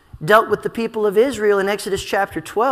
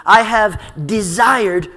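slow, louder, "desired" hit hard; emphasis on DESIRED; 5 dB louder, slower than usual. Shown as a demonstration. Preacher pointing out the verb form, emphatic on "desired". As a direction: stretch the phrase and punch "desired". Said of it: "it's for emphasis".

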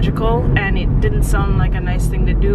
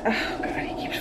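whisper; 11 dB quieter than usual. Real, not acted. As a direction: whisper so he stays asleep. Hushing near a sleepy baby.

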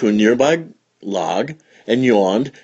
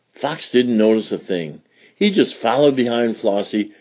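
usual speaking voice

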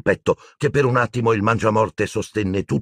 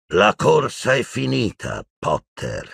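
lazy drawl with a sinking finish; 3 semitones higher, slower than usual. Acted. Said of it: "disse con voce strascicata".

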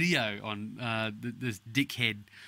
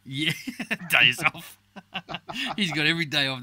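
laughing through the words; 5 semitones higher, 7 dB louder than usual. Real, not acted. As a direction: laugh while you say it. Laughing at the joke.

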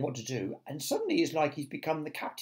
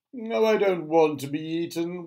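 high, loud wail; self-pitying cry; 4 semitones higher, 6 dB louder than usual. A narrator's reading. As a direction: wail it like a doomed worm. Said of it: "wailed the earthworm".

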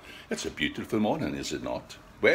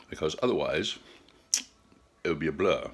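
ordinary delivery

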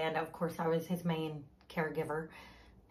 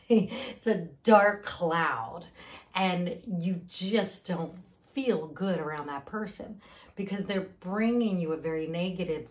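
usual speaking voice

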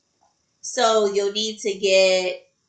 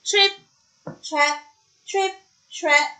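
higher, separated clip-clop words; light hoof beats; 7 semitones higher than usual. A narrator's reading. Second goat crosses the bridge, in high stepping beats. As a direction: tap out each word like a hoofstep.

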